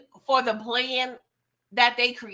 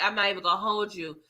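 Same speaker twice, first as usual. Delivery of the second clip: ordinary delivery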